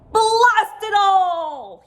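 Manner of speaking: high, loud, slow furious bellow. A narrator's reading. World chief raging at the missing men, bellowing. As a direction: roar it out in a rage. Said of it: "he bel-, bellowed".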